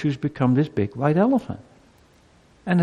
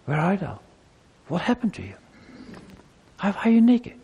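nice, gentle questioning; 6 semitones higher than usual. Acted. God confronting Adam after the fall, gently and nicely. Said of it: "God just confronted him nicely".

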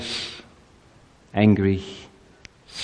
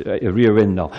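ordinary talk, nothing marked

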